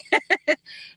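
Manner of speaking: a quick breathy laugh at herself; short airy laugh. Real, not acted. Streamer laughing after saying sorry to crying viewers, a breathy chuckle. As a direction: laugh it off lightly, half embarrassed. Apologizing for making viewers cry.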